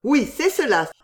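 high, emphatic agreement; urgent exclamation. A narrator's reading. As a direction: seize on the idea with a cry. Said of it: "s'écria Wentworth". (exclamation vive, voix haute)

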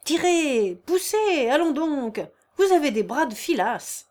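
loud, high impatient commands; shouting orders. A narrator's reading. Master berating straining workmen, shouting orders. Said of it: "monsieur Cardonnet criait de son côté".

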